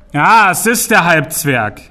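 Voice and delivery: high, loud and slow, gloating; big troll shouting in triumph from the cellar; triumphant troll call. Acted. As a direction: crow it out like a big troll who won. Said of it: "rief der große Troll triumphierend".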